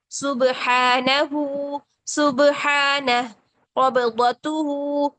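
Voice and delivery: slow drawn-out Arabic words, each with a pause; slow Quran recitation. Shown as a demonstration. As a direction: recite each word slowly for students. (धीमी तिलावत की मश्क)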